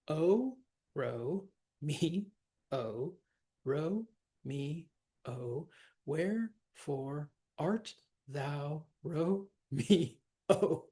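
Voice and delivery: slow, separate beats with pauses between syllables. Shown as a demonstration. Teacher counting a verse line's syllables for students, syllable by syllable. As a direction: count it out, each syllable on its own. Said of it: "let's count our syllables".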